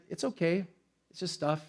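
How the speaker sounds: soft, calm letting go; calm reassurance. Retold or imitated. Pastor telling himself after the theft, soft calm reassurance. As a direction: let it go gently. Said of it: "I'm like".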